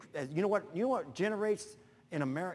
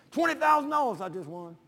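higher and louder, bragging; excited boast; 8 semitones higher, 7 dB louder than usual. Retold or imitated. Winner bragging to the preacher at the crane, excited. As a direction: crow about the win.

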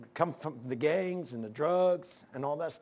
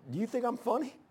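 laughing question; 8 semitones higher than usual. Retold or imitated. Laughing in the snow.

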